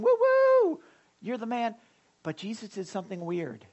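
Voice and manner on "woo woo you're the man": high whooping cheer, then a casual call; crowd cheering. Retold or imitated. Pastor acting the cheering crowd around Jesus, whooping high. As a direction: whoop like fans at a parade. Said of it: "They're all cheering him".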